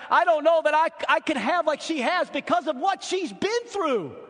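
fervent, swelling pulpit delivery; impassioned preaching. Real, not acted. Preaches about his wife's faith.

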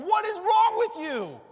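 very loud, high, slow yell; furious scolding. Pretend. Imagined Jesus confronting the disciples, loud high yell. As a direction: bellow it at them.

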